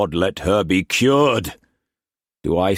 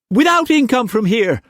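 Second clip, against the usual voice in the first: rushed on shakily; 6 dB louder than usual. A narrator's reading. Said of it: "she rushed on shakily".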